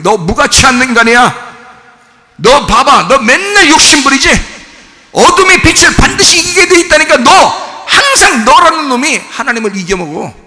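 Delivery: loud, high taunting devil. Retold or imitated. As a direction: jeer at them as the devil. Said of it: "사람들을 어떻게 해?". (크고 높게 조롱하는 마귀 목소리)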